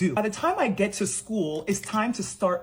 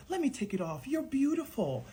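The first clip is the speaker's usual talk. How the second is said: soft reassuring teacher; 6 semitones higher, 6 dB quieter than usual. Retold or imitated. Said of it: "And she's like".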